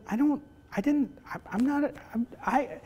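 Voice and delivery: high, halting tongue-tied start; flustered stammer. Retold or imitated. Father trying to explain himself to daughter, flustered stammering. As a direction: trip over every word, lost for words. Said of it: "I said".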